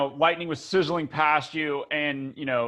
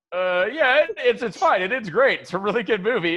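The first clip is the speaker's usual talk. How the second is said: higher, louder, unconvincing reassurance; mock reassurance; 7 semitones higher, 4 dB louder than usual. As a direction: imitate covering up bad news in a raised voice. Covering up a sad film ending.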